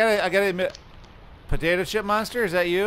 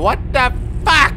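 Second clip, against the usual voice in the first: startled outburst; 8 semitones higher, 7 dB louder than usual. Real, not acted. Startled by the monster.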